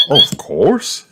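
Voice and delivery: high, obliging mum voice; Mummy Pig. A narrator's reading. Mum answering a playdate request, high agreeing voice.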